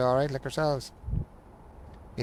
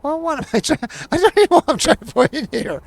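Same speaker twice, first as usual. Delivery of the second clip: much higher, louder frustrated rant; higher, louder exasperation at the fiddly ship; exasperated outburst; 11 semitones higher, 11 dB louder, faster than usual. Real, not acted. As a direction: let the frustration burst out, fast. Frustrated building in game.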